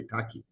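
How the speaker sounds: "Taki" with the S dropped; name without S. Shown as a demonstration. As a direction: say "Taki", no final S. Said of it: "you omit the S and you just say".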